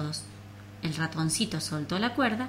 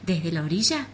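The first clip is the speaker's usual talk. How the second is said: ordinary delivery, nothing marked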